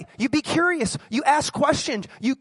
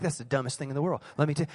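dismissive mutter; 9 semitones lower, 6 dB quieter, faster than usual. Retold or imitated. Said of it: "you're like".

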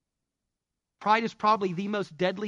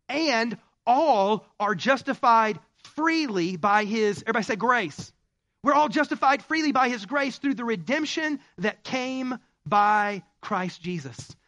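loud, slow, soaring preacher read; booming pulpit scripture, high and loud; preaching scripture; 6 semitones higher, 6 dB louder, slower than usual. Acted. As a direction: proclaim it to the congregation, drawn out. Preaching scripture at full force.

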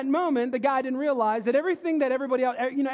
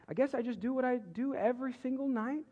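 innocent deadpan; 8 dB quieter than usual. Retold or imitated. Retold son's innocent punchline.

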